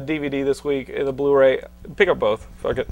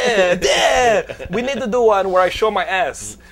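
a hint of Van Damme's Belgian-French accent pitching a film; Jean-Claude Van Damme impression; 3 semitones higher than usual. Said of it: "That's what the meeting would sound like".

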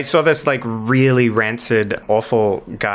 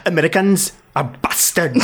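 put-on Welsh accent, pitched up; 5 semitones higher than usual. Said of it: "Okay, say that".

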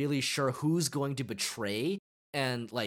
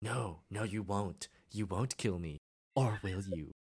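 creepy villain; 5 semitones lower, 5 dB quieter than usual. Retold or imitated. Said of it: "he's just like".

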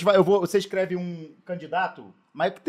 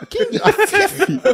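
incredulous laugh; 12 semitones higher, much slower than usual. Real, not acted.

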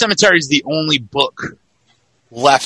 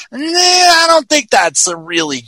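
mock scoffing reply to people; dismissive; 3 semitones higher, 4 dB louder than usual. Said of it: "And I'm just like".

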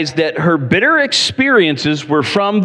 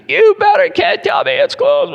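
mock furious outburst. Angry passenger exploding at the gate agent, much higher. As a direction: blow a gasket at the agent. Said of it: "he blows a gasket".

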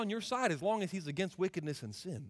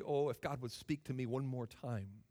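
much softer and lower, wistful plea; quiet longing; 6 semitones lower, 7 dB quieter than usual. Acted. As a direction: voice a hushed, regretful wish. Voices a regretful person's longing.

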